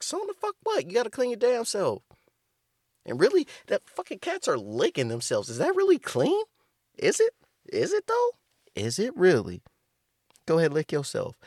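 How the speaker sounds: outraged squeal at the cat owners; incredulous rant. Real, not acted. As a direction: scoff at them, voice cracking high. Ranting against cat owners.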